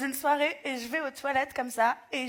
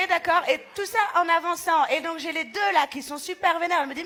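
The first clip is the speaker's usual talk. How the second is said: ordinary talk, nothing marked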